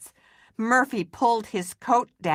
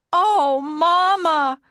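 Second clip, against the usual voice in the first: much higher, louder, slow relieved cry; 10 semitones higher, 6 dB louder, slower than usual. Acted. Maxwell crying out to his mother in relief. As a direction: melt with relief at seeing her. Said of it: "cried Maxwell".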